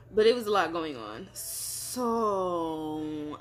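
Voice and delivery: normal talking voice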